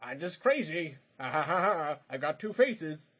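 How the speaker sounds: hint of a crazed villain with a fake cackle; lightly mocked crazy villain. Mocking a movie villain's performance to friends, lightly hammed-up craziness. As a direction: mimic an over-the-top madman, fake laugh thrown in. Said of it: "he basically acted like".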